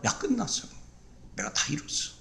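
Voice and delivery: hushed, tender voice of Jesus; soft voice of the Lord. Acted. Jesus gently tells the worried believer it is done, hushed. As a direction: breathe the words softly as Jesus. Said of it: "주님은 뭐라고 해요?".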